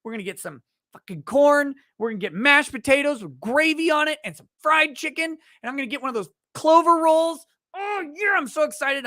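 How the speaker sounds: put-on a kid bouncing with joy over his dinner plan; child at the buffet line, getting giddier with every dish; excited kid. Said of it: "You're like".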